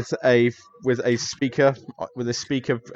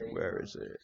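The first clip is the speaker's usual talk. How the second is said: muttering to himself; 8 dB quieter than usual. Acted. Professor rummages in desk.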